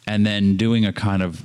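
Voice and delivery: usual speaking voice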